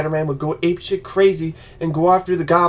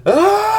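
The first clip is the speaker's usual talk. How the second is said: anguished scream; 6 dB louder than usual. Retold or imitated. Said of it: "some Christopher Reeve moment".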